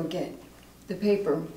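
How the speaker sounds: soft, halting confession; ashamed recovering alcoholic. Acted. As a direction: confess it quietly, with long pauses. Acting exercise, recovery meeting confession.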